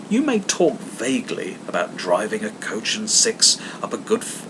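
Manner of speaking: plain reading voice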